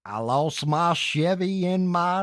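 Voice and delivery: slow, high Southern drawl read; Southern accent. Pretend. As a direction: stretch it out with a Southern twang. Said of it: "who has the better Southern accent".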